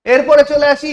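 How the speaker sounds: normal talking voice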